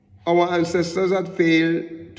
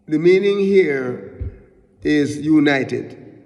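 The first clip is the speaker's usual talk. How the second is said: usual speaking voice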